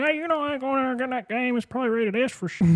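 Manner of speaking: high put-on old woman voice; mamaw one-liner. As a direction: play a sassy mamaw. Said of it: "she's like".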